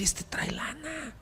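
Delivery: high, slow scheming whisper; Judas whispering. Retold or imitated. Preacher voices Judas whispering to the Lord, greedy. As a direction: lean in and hiss it to the Lord like a greedy disciple. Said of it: "Y por acá Judas".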